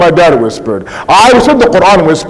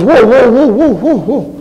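higher, protesting string of whoas; mock-alarmed devil protesting his innocence; startled Shaitan; 6 semitones higher than usual. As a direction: back off with a rapid, wavering whoa. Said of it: "Shaitan goes".

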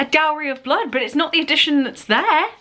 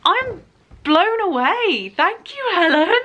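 overwhelmed delight; 8 semitones higher than usual. Real, not acted. Overwhelmed by a bookish present.

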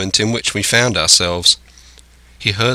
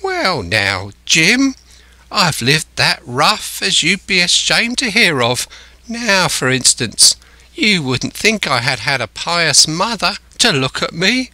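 put-on higher-pitched old sea hand's voice; lilting castaway talk, pitched up; castaway, confiding; 7 semitones higher than usual. A narrator's reading. Castaway telling Jim of his rough life, confiding.

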